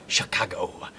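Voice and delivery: soft, low build-up to a film quote; low, muttered Chicago. Retold or imitated. Streamer setting up a film reference, low and soft. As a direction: say CHICAGO low and softly, as a setup. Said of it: "that's an Ace Ventura two reference".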